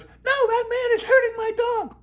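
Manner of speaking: shrill protest pinned on the man; the owner protesting to the trooper in a high hammed-up voice; indignant dog lady. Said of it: "she goes".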